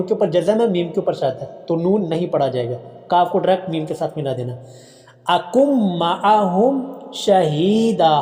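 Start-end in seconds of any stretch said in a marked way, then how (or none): 5.3-8.2 slow chanted recitation, हा held long; Quran recitation. Shown as a demonstration. Teacher reciting Quran for students, slow drawn-out chant. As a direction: recite slowly, stretch the हा. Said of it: "हा को लंबा करेंगे".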